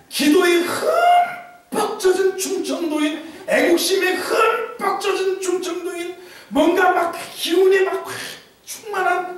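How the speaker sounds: high, laughing singsong with 흠뻑 lifted; singsong praise. Acted. Preacher to congregation, praising the prayer-soaked, in a high laughing singsong. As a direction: sing out 흠뻑 젖은 충청도인 like a happy chant.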